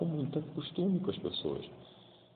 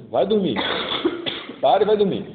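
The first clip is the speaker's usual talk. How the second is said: loud, higher fed-up order; "dormir" barked out, loud and higher; exasperated shout; 7 semitones higher, 9 dB louder, faster than usual. Retold or imitated. Eli shouting at the boy to go back to sleep. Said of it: "o próprio Eli, né, fala".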